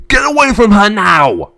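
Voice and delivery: high, loud warning; angry shouted order. Acted. Brother protecting his sister, shouting angrily. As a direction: drive him off with a shout.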